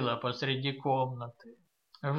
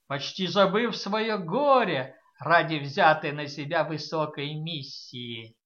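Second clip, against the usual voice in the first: ordinary delivery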